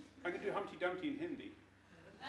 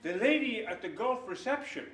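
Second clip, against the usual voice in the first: usual speaking voice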